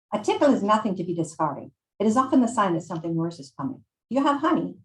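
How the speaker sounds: put-on Italian-accented old woman giving a warning; butchered Italian accent; Maria, Italian accent. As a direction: lecture with a put-on Italian accent, lifting on the question. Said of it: "I'm going to butcher an Italian accent".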